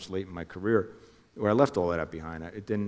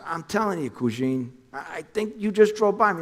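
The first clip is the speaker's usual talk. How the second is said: much higher, urgent voice for Jimmy; imitating Jimmy calling, pitched way up; Jimmy on the phone; 10 semitones higher, 4 dB louder than usual.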